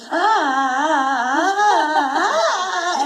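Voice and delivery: loud, long, repeated mock screams; high, loud acted "ah" screams; demonstrated scream. Shown as a demonstration. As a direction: keep screaming on cue till air runs out. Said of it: "I have to start screaming".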